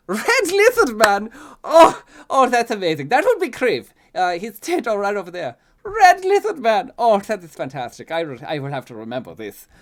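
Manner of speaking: high, loud theatrical gentleman; delighted exclaiming. A narrator's reading. Fancy man delighted by nickname.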